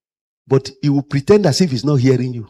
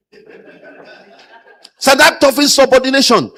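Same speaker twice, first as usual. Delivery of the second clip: much higher, slow, with a laugh; mock the boss's pompous charge; 10 semitones higher, 4 dB louder, slower than usual. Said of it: "I'm quoting them".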